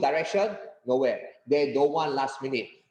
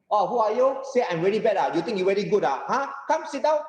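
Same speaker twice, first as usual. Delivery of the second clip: raised pitch, confrontational comeback; forceful challenge; 7 semitones higher than usual. Acted. Third-third person answering a critic, forcefully challenging. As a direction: dare the critic to prove himself. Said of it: "forceful driving, so this is how am I going to react if I get a critic".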